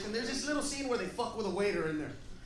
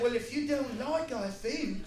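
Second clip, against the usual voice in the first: mock English waiter, rising in volume; put-on English accent, building to a loud insult; English waiter's accent; 5 semitones higher, 6 dB louder than usual. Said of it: "the waiter was like".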